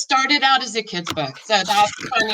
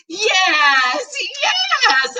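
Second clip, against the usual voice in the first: excited call; 10 semitones higher than usual. A narrator's reading. Girl finding her cat behind the dryer, joyful shout. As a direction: shout it with relief. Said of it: "I call out".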